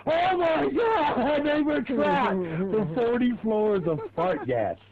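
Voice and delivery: high, very slow shocked shout; mock horror. Mocking the trapped suits' reaction, a slow high shocked shout. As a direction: ham up their horror at the fart gas.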